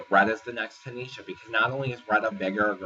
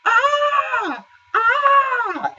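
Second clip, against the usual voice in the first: high piercing mock scream, twice; hammed-up repeated scream; imitated screaming; 10 dB louder than usual. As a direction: shriek like a brat trying to annoy. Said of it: "Lindsay starts screaming".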